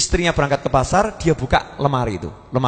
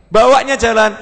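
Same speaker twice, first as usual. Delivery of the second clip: normal talking voice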